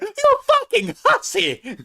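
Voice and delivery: very high, drawn-out mock accusation; hammed-up scorned lover; mock jilted lover. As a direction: draw out the insult in falsetto. Acted jilted lover's phone call.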